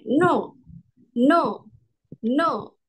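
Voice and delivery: mock-angry falling fourth tone. Shown as a demonstration. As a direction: snap no down like an angry refusal. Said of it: "kayak orang marah bilangnya".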